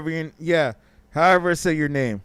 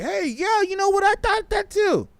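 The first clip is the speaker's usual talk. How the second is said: much higher mock gullible voice. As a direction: squeak it like a dupe. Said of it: "you're like".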